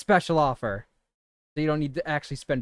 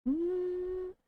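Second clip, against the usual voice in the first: very high, softer puppet hum; Elmo warm-up hum; 6 dB quieter than usual. Shown as a demonstration. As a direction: find the falsetto on a held hum. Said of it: "I gotta work it".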